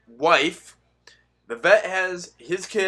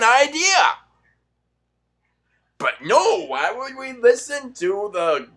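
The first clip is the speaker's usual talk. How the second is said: raised, loud, biting sarcasm; sarcastic rant; 6 semitones higher, 5 dB louder, slower than usual. Real, not acted. Ranting at Biden voters with loud sarcasm. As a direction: drip with scorn.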